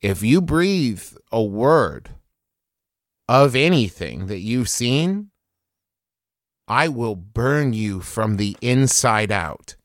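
deliberate, measured menace; slow intimidating warning with heavy pauses; slow threat. Acted. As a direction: threaten him slowly, letting each phrase land. Threatening the captive in character.

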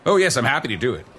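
ordinary delivery

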